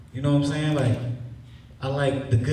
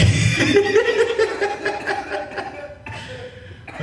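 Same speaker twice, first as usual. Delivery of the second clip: high wheezing laugh. Real, not acted. Laughs taunting officer.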